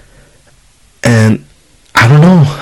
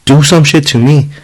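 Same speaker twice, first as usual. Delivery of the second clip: mild, raised-pitch protective scolding; retold yelling; 5 semitones higher than usual. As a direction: retell warning off someone bothering your child. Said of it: "And I started yelling".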